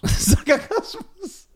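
Sarkasmus drawn out very slowly, high and laughing, mimicking the stumble; mocking repeat. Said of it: "Sarkasmus (friend stumbles on word)".

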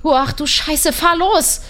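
high, loud panicked shout; agitated scream. A narrator's reading. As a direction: yell for a fast getaway. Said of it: "schrie ich aufgebracht".

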